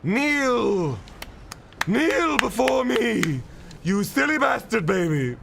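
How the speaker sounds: booming villain gloat; megalomaniac rant. Acted. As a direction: lord it over the beaten boss. Gloating over beaten boss.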